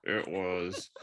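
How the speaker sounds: "It was" squeezed out through clenched teeth; through gritted teeth. Retold or imitated. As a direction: grit your teeth and mutter "It was". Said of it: "She said through clenched teeth".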